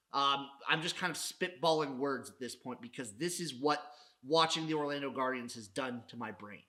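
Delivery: ordinary talk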